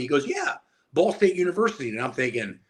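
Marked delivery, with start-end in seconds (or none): 0.3-1.9 higher, matter-of-fact pride; proud coach. Retold or imitated. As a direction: say the school like it's famous. Coach proudly names school.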